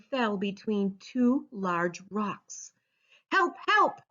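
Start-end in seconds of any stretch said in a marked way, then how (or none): 3.3-4.0 high, frantic cry for help; calling for help. A narrator's reading. Crab stuck between rocks calls for help, high and frantic. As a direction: shout it up from the rocks. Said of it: "called King".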